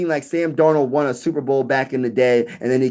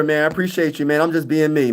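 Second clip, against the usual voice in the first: ordinary talk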